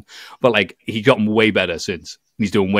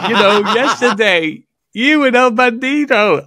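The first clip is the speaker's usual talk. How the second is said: laughing recall; 13 semitones higher, 5 dB louder, slower than usual. Retold or imitated. Retelling talking to a wrestler.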